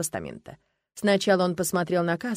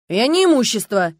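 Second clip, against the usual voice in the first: loud, raised, hurt protest; offended exclamation; 4 semitones higher, 9 dB louder than usual. A narrator's reading. Youth objects to being called property, offended. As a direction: cry out indignantly. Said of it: "обиженно воскликнул парень".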